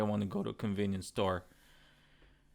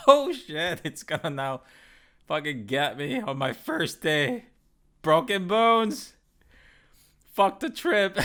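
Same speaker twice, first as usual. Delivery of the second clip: mock panic; 5 semitones higher, 6 dB louder than usual. Boar charging out again.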